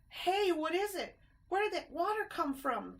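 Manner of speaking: high alarmed cry; startled by the wave; frightened cry. A narrator's reading. Ted startled by the flood, high and alarmed. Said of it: "cried Ted".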